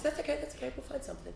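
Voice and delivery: hushed, placating reassurance; soft soothing. Retold or imitated. Church lady placating the boy after his denial, very soft. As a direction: soothe very softly, dropping away.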